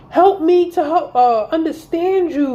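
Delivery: high-pitched mock plea, slowing at the end; imitated boyfriend text. Woman quotes boyfriend asking to understand, mocking. Continues mimicking his text.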